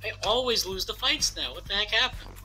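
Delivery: usual speaking voice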